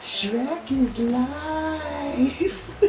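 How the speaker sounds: high, slow drawn-out proclamation; high, slow mock devotion; mock solemn. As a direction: preach it like a sacred motto.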